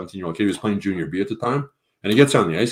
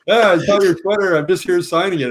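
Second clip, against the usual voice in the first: casual Crosby quote; 9 semitones higher, 4 dB louder than usual. Retold or imitated. Crosby speaking on the phone.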